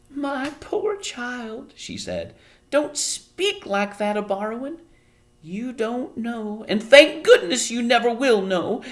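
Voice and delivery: high, slow, grave; sorrowful warning. A narrator's reading. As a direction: pity the child. Homily solemn.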